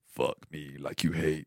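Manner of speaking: very low, quiet, deep murmur; [name] voice. Retold or imitated. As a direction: drop the voice low and soft like [name]. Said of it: "imagine it in [name] voice".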